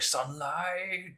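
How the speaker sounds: high, drawn-out call; imitated game character. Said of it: "and he'll go like".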